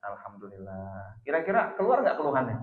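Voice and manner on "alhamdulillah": gentle coaxing. Retold or imitated. Husband asks wife to begin with praise, gently coaxing.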